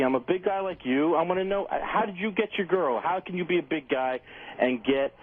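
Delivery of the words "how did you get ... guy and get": usual speaking voice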